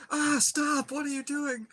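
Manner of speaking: very high cry of protest; loud high burst, easing in volume; alarmed grandma, imitated. Grandma begging screaming kid to stop, mock alarm.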